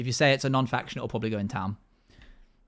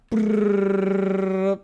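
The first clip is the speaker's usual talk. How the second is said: rolled brrr; 5 semitones higher than usual. Real, not acted. Roulette spinning, drawn-out noise.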